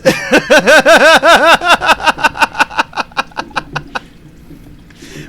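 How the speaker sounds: loud, high burst of laughter; really cracking up; big laugh. Real, not acted. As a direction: break into loud laughter over the story. Cracks up after father quote.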